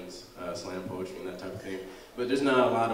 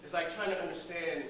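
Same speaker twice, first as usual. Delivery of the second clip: higher, impassioned stage delivery with long pauses; dramatic monologue; 6 semitones higher than usual. Acted. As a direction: recount a lost love to an audience, pausing for weight.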